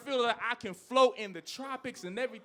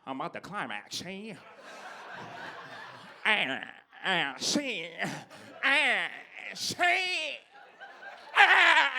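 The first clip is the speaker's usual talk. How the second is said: fifties man climaxing; slower than usual. Retold or imitated. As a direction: ham up stiff breathy climax grunts. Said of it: "he be like".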